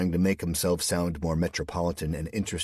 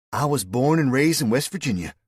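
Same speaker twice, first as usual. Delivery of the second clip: put-on high, loud West Virginia accent; 8 semitones higher, 8 dB louder than usual. A narrator's reading. Woman tells Cal her origins in a loud West Virginia accent. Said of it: "Your accent".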